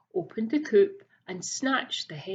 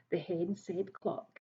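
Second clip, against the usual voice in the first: usual speaking voice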